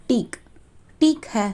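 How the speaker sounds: டீக் ஹை as "theek hai", long ee; Hindi pronunciation. Shown as a demonstration. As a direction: break டீக் off first, then model டீக் ஹை as theek hai. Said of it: "ஹிந்தியில எப்படி pronounce பண்ணணும்". (டீக் ஹை, இந்தி உச்சரிப்பில் "டீக் ஹை")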